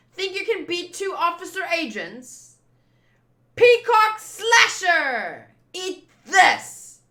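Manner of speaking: high, loud attack call, dragging out Peacock Slasher; shouted battle cry. Acted. Agent attacking Vivi, loud high battle shout. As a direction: yell the attack name with full force.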